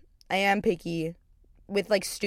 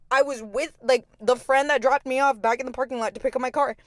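normal talking voice

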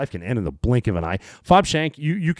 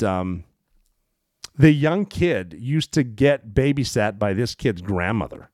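normal talking voice

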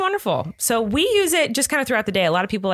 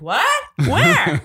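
high, loud clueless "where?"; puzzled mock outburst; imitating someone baffled; 7 semitones higher, 5 dB louder than usual. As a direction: mimic his total confusion, pitch leaping up. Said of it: "he's like".

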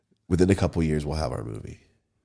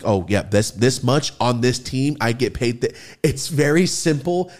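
booming prize announcer; 3 semitones higher, 4 dB louder than usual. Acted. Sponsor ad read.

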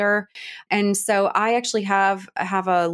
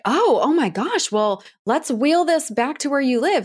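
sympathetic; 5 semitones higher than usual. Retold or imitated. New suburban parents cooing sympathy at the stranded boy. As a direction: coo over him like a lost child. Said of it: "And we were like".

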